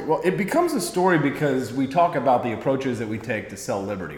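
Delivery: normal talking voice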